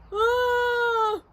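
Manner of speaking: long, high cry of fright; high scream. Acted. Bert believes his tongue is spotted, high scream. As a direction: let out a sustained high wail.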